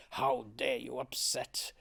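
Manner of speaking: low, slow imitation of a sour boss; mock a sour reprimand, low and drawn out; lightly hammed-up sour scolding. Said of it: "like, sour".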